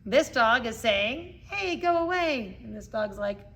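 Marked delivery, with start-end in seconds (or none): 1.5-2.6 higher-pitched mock warning from a dog; lightly voiced dog telling another to back off. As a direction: call it out high, playing the dog.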